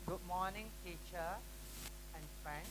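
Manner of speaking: barely audible, hurried imitation of a shy student presenting; timid student presenter. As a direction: mumble it like a nervous student reading a script. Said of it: "do you talk like this?".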